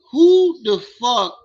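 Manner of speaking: high, loud, slow disbelieving outrage; drawn-out indignant who the fuck; outraged outburst. Real, not acted. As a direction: explode at the screen in disbelief. Outraged at the clip.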